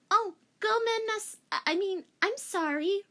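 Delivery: put-on high, flustered anime girl apology; apologetic schoolgirl. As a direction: squeak out a sorry.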